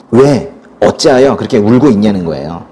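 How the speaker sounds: ordinary delivery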